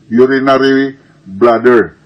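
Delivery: normal talking voice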